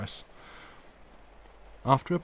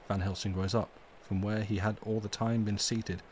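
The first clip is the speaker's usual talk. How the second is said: ordinary delivery, nothing marked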